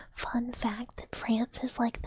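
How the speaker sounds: ordinary talk, nothing marked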